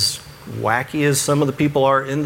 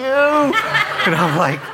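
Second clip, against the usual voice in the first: laughing; 4 semitones higher, much slower than usual. Real, not acted. Laughing at own mistake.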